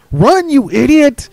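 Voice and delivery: put-on boy yelling at the stage in frustration; exasperated shout. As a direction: yell at the frozen actor. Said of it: "Alexander shouted".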